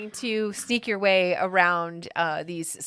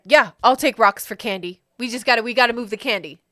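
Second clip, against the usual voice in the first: louder, hurried goblin salesman; eager-to-sell creature voice; goblin vendor agreeing; 3 semitones higher, 7 dB louder than usual. Acted. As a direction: take the deal briskly as the goblin. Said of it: "he's like".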